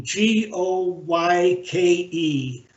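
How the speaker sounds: slow, letter-by-letter spelling; measured spelling for someone taking it down; spelled out. Real, not acted. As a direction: spell it out slowly, one letter at a time. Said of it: "How do you spell Matt's last name?".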